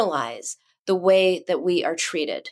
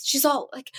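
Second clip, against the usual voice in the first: ordinary delivery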